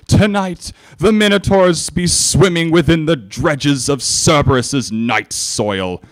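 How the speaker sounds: put-on high, loud drunken victory proclamation; royal voice let loose. Tipsy princess toasts the cheering soldiers, booming. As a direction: bellow it to the whole hall. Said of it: "set loose the voice".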